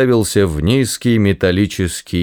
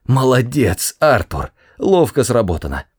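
plain reading voice

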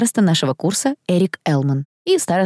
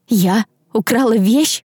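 raised, disbelieving question turning to protest; upset denial; 5 semitones higher than usual. A narrator's reading. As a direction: deny it to friends with raised pitch. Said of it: "замотала я головой". (взволнованное отрицание)

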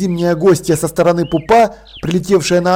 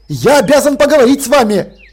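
heatedly; 6 semitones higher, 4 dB louder than usual. A narrator's reading. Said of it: "горячо повторил Деслов".

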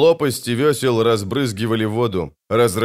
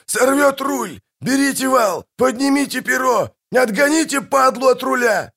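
frantic, high-pitched yelling; bellowing. A narrator's reading. Captain ordering crew to save the rudder, bellowing. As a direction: yell orders over the chaos. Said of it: "орал Пудлорак".

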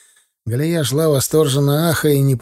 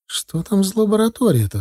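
higher-pitched curious question; curious wife; 8 semitones higher than usual. A narrator's reading. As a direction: ask with curiosity in a raised voice. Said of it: "с любопытством спросила жена". (любопытный вопрос жены)

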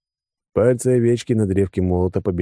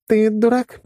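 very high-pitched woman's question; blonde's retort; 4 dB louder, slower than usual. Acted. Blonde challenging the surprised man, high pointed question.

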